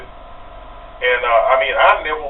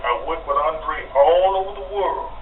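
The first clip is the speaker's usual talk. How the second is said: ordinary talk, nothing marked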